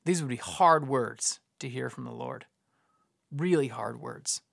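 low, soft and solemn; hushed gravity. Real, not acted. Preacher reflecting on hard words from the Lord, hushed gravity. Solemn reaction to God's refusal.

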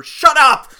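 exploding in a very loud, high yell; furious shout. Real, not acted. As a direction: cut them off with a full-force shout. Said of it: "I'm so pissed off right now".

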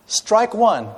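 loud, punchy call of the count. Acted. Preacher calling the count to the congregation, loud and punchy. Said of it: "preacher calling out a count".

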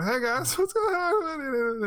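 high, drawn-out hammed-up intro; over-the-top youtuber greeting. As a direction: stretch the greeting way up like a hyped youtuber. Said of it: "by the end of the video, I'm going".

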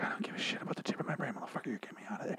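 rapid, barely audible whisper. Acted. Robot woman dismissing the brain chip, hurried mock whisper. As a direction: hiss it all out in one rushed breath. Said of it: "Why are you whispering so much?".

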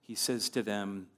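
ordinary talk, nothing marked

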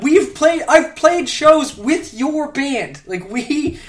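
loud, high, drawn-out indignation; exasperated reproach. Retold or imitated. Musician confronting the drummer who booked them, indignant reproach.

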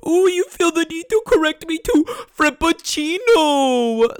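high, loud sarcastic mimicry with a gasp; hammed-up sarcastic customer voice; mocking the customer. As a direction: ham up the fake offense. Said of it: "Sorry, Cody's texting me".

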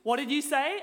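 loud, high demanding what; loud challenge. Retold or imitated. Woman confronting catcaller, loud demanding question. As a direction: call them out loud and high. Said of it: "I'd be like".

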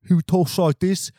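broad mock English accent; comic broad English accent; first English voice type. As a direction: put on a hammed-up British guy. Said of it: "two types of voices in England".

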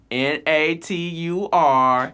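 spelling it out letter by letter, slow and loud; deliberate spelled-out handle. Shown as a demonstration. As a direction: spell each letter slowly and clearly. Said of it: "Natural Soap by Key".

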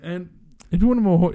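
ordinary delivery

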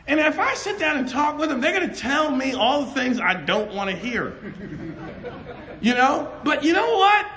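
loud, high preacher shouting with laughs; preaching at full force. Acted. Preacher rallies his congregation in a loud, high shout. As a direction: fire it at the crowd like a revival.

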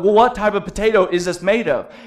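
exasperated retold questioning; retold incredulity. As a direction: press for an answer in mock disbelief. Retold challenge about fake potatoes.

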